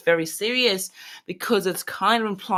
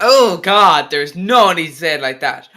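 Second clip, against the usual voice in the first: loud exasperated outburst; 2 semitones higher, 11 dB louder than usual. Real, not acted. Streamer reacting to a clip, loud and exasperated. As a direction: exclaim it loudly in exasperation.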